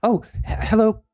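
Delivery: put-on nervous kid tripping over his words to the elders; nervous stammering kid. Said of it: "and he's like".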